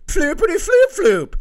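high, loud goofy singsong; silly mocking nonsense. Comic mocking what dads' calendars say, goofy and loud.

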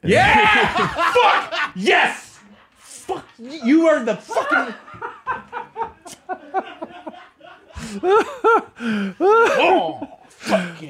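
triumphant, high-pitched yelps through laughter; ecstatic celebration. Real, not acted. Host hears his theory confirmed, ecstatic laughing cheers. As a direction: whoop and crow as if vindicated.

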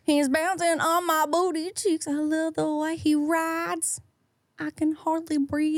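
high twangy country singer voice; sing-talk it like a country star, slow; country accent. Pretend. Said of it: "Can we hear your country accent?".

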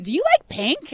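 high-pitched imitation of a girl calling out; mock child's yell. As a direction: imitate a little girl's eager yelled question. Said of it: "she yells out".

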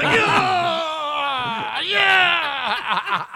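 high, loud whooping yeah; cheering. Retold or imitated. Students cheering at the professor's promise, loud whoop. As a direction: cheer like you just got a good grade. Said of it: "And we're like".

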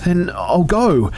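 a tiny, high, slow squeak; frightened squeak of surrender. Acted. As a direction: squeak it out in fear. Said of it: "I squeaked".

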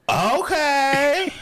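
high loud drawn-out okay; in-character okay. Pretend. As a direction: launch into a hammed-up read. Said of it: "You have to get in character".

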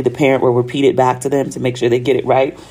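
normal talking voice

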